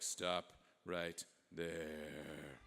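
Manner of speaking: very slow, low and quiet halt; commanding Napoleon. Acted. Napoleon halting the envoy at the door, low and commanding. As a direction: freeze him with a low, drawn-out order. Napoleon halts the departing buyer.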